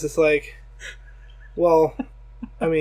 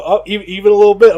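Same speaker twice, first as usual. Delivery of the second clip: higher, louder, quick complaint; mock patient whine; 5 semitones higher, 11 dB louder, faster than usual. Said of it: "they go".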